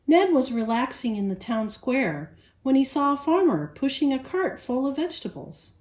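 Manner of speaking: ordinary delivery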